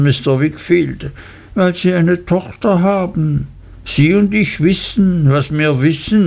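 grovelling while he threatens; humble Uriah. Acted.

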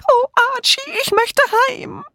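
very high, pleading whimper; frightened wail. A narrator's reading. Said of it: "alle riefen wirr durcheinander".

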